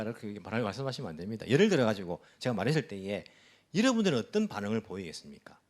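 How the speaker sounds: usual speaking voice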